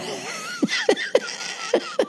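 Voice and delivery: squealing high-pitched laugh. Real, not acted. Host laughing at his own punch-drunk story. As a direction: burst into a high giggle.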